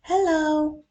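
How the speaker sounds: loud, high call over the fence; high, loud hello. Acted. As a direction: call out to the neighbour. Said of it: "Alfred called".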